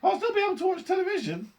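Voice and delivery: tiny, very high defiant squeak; loud little piping voice; Mike T V. A narrator's reading. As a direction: squeak it like a mouse-sized boy. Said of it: "squeaked the tiny voice of Mike T V".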